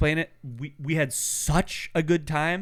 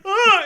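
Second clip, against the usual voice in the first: startled scream; 9 dB louder than usual. Retold or imitated. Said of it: "And he's like".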